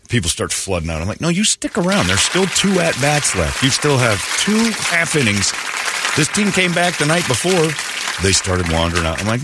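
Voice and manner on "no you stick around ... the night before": higher-pitched mock protest to fans; indignant fan appeal, acted out; insistent urging. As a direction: plead with them to stay, voice raised in pitch.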